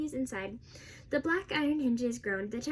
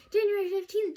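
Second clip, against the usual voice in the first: higher, louder cry of disbelief; exclaiming; 8 semitones higher, 6 dB louder than usual. A narrator's reading. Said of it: "Jessie exclaimed".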